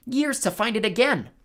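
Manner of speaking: high-pitched moan of complaint. A narrator's reading. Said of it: "Wasp moaned".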